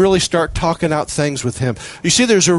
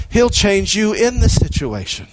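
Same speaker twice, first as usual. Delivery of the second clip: ordinary talk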